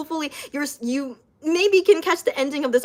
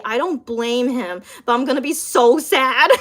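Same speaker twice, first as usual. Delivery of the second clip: ordinary talk, nothing marked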